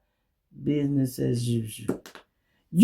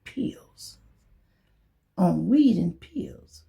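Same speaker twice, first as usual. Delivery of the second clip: hushed whispered aside, slow; mock whisper; 3 semitones higher, much slower than usual. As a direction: whisper it like a secret confession. Mocking what he told her.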